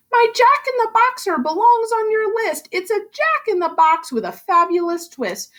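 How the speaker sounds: high, proud little elf voice. Acted. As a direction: show off the gadget like a salesman elf. Elf pitches his invention.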